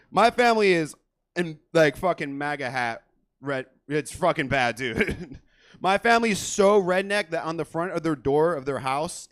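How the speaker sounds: ordinary talk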